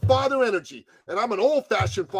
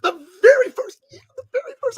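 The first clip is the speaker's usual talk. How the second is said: climbing high with each repeat; repeating the praise louder and higher; rising emphasis; 6 dB louder, faster than usual. Retold or imitated. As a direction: build it up gleefully.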